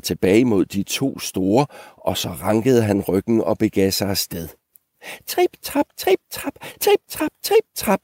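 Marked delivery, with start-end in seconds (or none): 5.3-8.0 very high, light, staccato patter; tiny hoof steps. Acted. Little goat's hooves on the bridge, tiny high staccato. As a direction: squeak each step out tiny and separate. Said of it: "lød hans hove mod træbroen".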